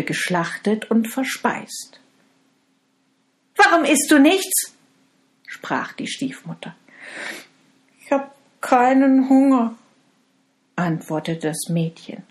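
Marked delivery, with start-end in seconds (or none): 3.6-4.7 much higher, louder demand; suspicious stepmother. Acted. Stepmother questions girl. 8.0-9.8 put-on higher, louder young girl; sad girl. Girl answers stepmother.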